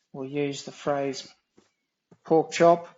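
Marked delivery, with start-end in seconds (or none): 2.3-2.9 "pork chop" called out much louder, rising at the end; loud Aussie exclamation. Shown as a demonstration. Explaining Aussie slang to viewers, a loud exclaimed "pork chop". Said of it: "Aussies do like to say".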